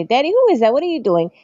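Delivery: put-on high, curious voice of a four-year-old; little girl asking. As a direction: play the wide-eyed little girl asking her dad. Child asking dad about TV.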